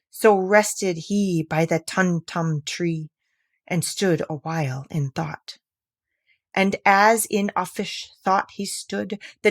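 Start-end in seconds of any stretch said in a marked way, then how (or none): none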